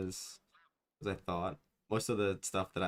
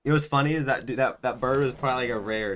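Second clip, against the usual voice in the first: loud excited; 4 semitones higher, 7 dB louder than usual. Real, not acted. Excited about rare bird.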